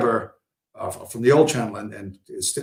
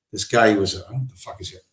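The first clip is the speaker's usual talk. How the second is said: usual speaking voice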